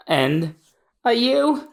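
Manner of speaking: high rising question; hopeful child. Acted. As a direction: ask it timidly. Robin asks Olvia.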